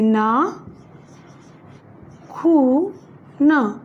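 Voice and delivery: ना, खू, न split with pauses; syllable by syllable. Shown as a demonstration. Teacher spelling for students, slow syllable by syllable. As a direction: break the word into its syllables. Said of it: "बड़े ऊ की मात्रा आती है".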